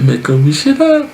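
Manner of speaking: high mocking squeak; mock fairy squeal. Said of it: "elle a fait".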